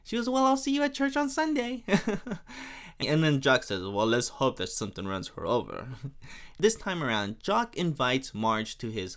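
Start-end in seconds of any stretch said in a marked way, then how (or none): 0.3-1.8 very high, put-on busybody voice; hammed-up high-pitched churchgoer; gossip's pointed goodbye. 3.8-5.9 low mock French accent; lightly hammed French aside. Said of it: "it's just such a fun accent to do".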